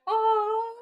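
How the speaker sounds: high, mock-gushing aww; Brad Creaser cooing. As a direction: coo like a charmed fan. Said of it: "I bet Brad Creaser was like".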